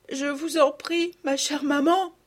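aiguë et lente, implorante; supplication d'enfant. Acted. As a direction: une fillette supplie sa maman. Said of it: "en joignant les mains". (supplique d'enfant aiguë et lente)

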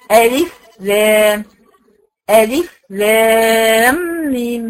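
ألف لام ميم stretched slowly, letters held long; teacher demonstrates ألف لام ميم for her class, slowly; slow recitation. Shown as a demonstration. نطق بطيء وممدود. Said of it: "لازم أجيب الألف".

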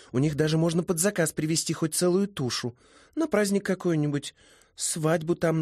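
put-on high, lively girlish voice; high-voiced, talkative young woman; friendly young hostess.

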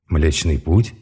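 rising, baffled echo of Млечный путь; puzzled question. A narrator's reading. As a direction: repeat it not understanding. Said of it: "Я непонимающе уставился".

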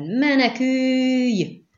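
very high, drawn-out yell; roared warning. A narrator's reading. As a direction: scream a long, loud warning across distance. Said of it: "ordította Skellen".

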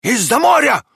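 high, loud excited cry; dog's shout. A narrator's reading. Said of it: "кричала собака". (громкий высокий крик собаки)

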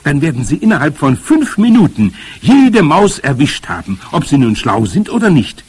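high, loud, gleeful prediction; jubilant. A narrator's reading. Boy predicts cats will catch all mice, jubilant. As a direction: crow over the sure success. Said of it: "jubilierte ich".

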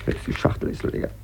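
whispered, broken down; despairing whisper. Acted. Alone after the call, whispering in despair. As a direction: breathe it out in defeat.

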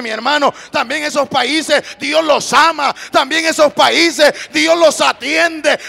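shouted, slow, high-pitched sermon climax; full-force preaching. Real, not acted. Preacher telling the church God attends the humble, full-force preaching. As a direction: build to a thundering shout of praise.

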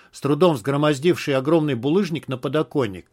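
plain reading voice

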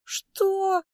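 very high, drawn-out shriek of disbelief; shocked squeal. A narrator's reading. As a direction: squeal it in outrage. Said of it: "аж взвизгнул Лео". (возмущённый взвизг)

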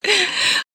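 soft, high giggle; short amused titter. A narrator's reading. Daughter amused at her mother's insult, giggling. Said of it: "Серафима хихикнула".

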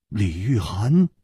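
usual speaking voice

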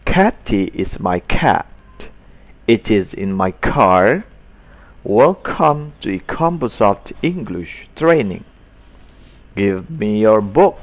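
sentences read slowly, each k aspirated; careful model reading. Shown as a demonstration. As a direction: read for the class to copy. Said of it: "dengan pronunciation yang benar".

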